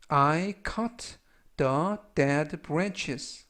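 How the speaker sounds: very slow, word by word English; slow model reading. Shown as a demonstration. Teacher repeating a sentence slowly for students to copy. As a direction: spell it out in sound, slowly.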